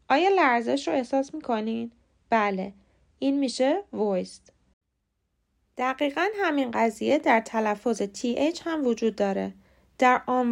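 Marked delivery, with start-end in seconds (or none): none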